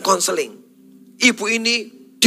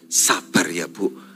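low, slow, soft pastor voice; hushed mock-tender reassurance; acted tender consoling; 12 semitones lower, 6 dB quieter, slower than usual. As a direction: comfort slowly with a hand on the shoulder. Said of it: "Waktu pegang pundaknya".

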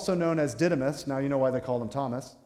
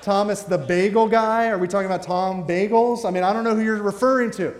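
raised, louder voice of mock confusion; baffled guessing; 4 semitones higher, 6 dB louder than usual. Man at heaven's gate guessing which Thomas, baffled. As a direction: guess wildly, more and more puzzled.

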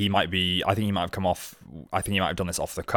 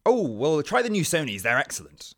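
shop assistant voice; 7 semitones higher, 5 dB quieter than usual. Acted. Shop worker answers in sketch.

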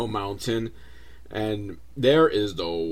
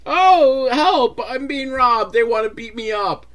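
very high, loud, slow hammed-up panic; mock cry for help; 11 semitones higher, 5 dB louder than usual. Tom Arnold calling the police, hammed-up panicked plea. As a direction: fake a helpless victim calling the police. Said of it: "calls over the police".

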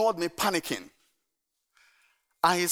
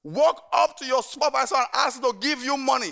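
firm instruction; 6 semitones higher than usual. Retold or imitated. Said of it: "I said".

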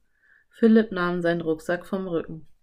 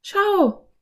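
put-on high, loud girl's call; 14 semitones higher, 5 dB louder than usual. A narrator's reading. Anne points Philipp to the scroll, high call. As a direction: call out, pitched right up. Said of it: "rief Anne".

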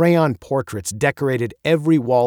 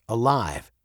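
usual speaking voice

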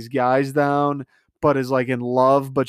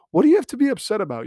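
quick and much higher, incredulous; fast exasperated rant; 8 semitones higher, much faster than usual. Real, not acted. Ranting about Claire's tears, fast high incredulous outburst. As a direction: rattle it off in disbelief.

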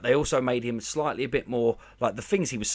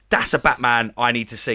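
normal talking voice

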